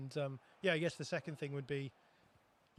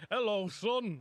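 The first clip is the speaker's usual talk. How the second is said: put-on Lancashire accent; 6 semitones higher, 6 dB louder, slower than usual. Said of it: "developed a Lancashire accent".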